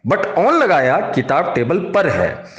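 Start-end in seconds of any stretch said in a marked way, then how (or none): none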